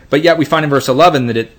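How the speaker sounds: usual speaking voice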